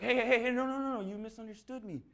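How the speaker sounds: panicked backpedal. Retold or imitated. Worker backpedaling to the angry customer: panicked. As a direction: scramble to calm him down.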